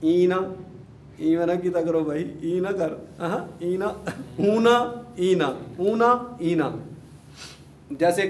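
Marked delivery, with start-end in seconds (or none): 0.0-0.4 اینا said louder, stressed; sound demo. Shown as a demonstration. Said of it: "نصب اور جر میں کیا ہوگا". 4.5-6.7 اونا and اینا, louder and higher, set against each other; contrast drill. Shown as a demonstration. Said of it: "اونا کی آواز ہے".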